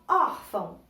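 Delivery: órfão with a strong first syllable OR; stress on first syllable. Shown as a demonstration. Teacher demonstrating tonic syllables, órfão stressed on its first syllable. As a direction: lean on the "ór" of órfão. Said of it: "Qual é a sílaba mais forte?".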